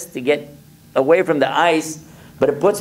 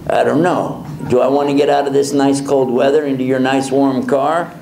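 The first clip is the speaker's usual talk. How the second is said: ordinary talk, nothing marked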